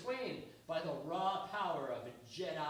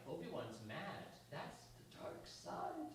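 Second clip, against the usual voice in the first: hushed; 4 semitones lower, 10 dB quieter than usual. Retold or imitated. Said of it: "people are like".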